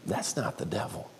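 soft, inward realization; hushed inner voice; quiet thought. Retold or imitated. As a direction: say it softly to yourself. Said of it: "And I thought".

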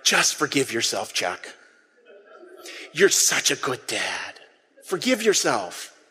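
a tender pat-on-the-back tone; gentle reassurance. Pretend. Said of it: "seen how mortified I was and gone".

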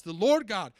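"Lord" hit hard and loud, falling; stress on Lord. Shown as a demonstration. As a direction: boom out "The Lord God" with weight on "Lord". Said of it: "He says, watch".

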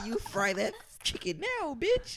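high, slow scolding put-on mom voice; a mom barking at her son over the oil; mock snapping mom.

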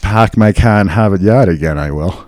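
r-dropping Boston accent. Shown as a demonstration. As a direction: Pack my car in Harvard Yard. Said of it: "There you go".

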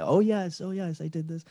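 Ilya. Retold or imitated. Caller confirms his past work, imitated Hungarian accent. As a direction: answer as the Hungarian caller, voice lifted. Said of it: "in his Hungarian, in his accent".

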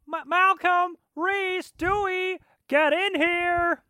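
very high, slow, drawn-out mother's call; a mother calling her sons, stretched high; Lois impression. Host shows his Lois calling the boys, high and slow. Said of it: "Great, great Reese impression".

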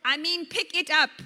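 loud, emphatic storybook correction; projected, comically exasperated bush; said loudly. A narrator's reading. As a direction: correct him at full volume. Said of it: "The bush said loudly".

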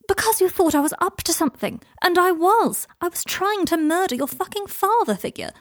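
loud, high-pitched, worked-up confession; exasperated outburst, pitched high; heated retort. Acted. Heated retort in lovers' argument.

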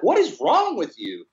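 put-on high, slow toddler voice; a small child baffled at dad; imitating his toddler. Three-year-old daughter staring at her sick dad, high toddler voice. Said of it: "She's like".

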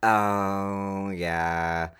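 ordinary talk

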